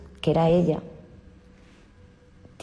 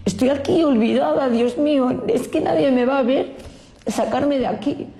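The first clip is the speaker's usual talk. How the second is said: desperate pleading; 6 semitones higher than usual. Retold or imitated. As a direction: cry out as if no one will ever see you. Forgotten in purgatory, begging.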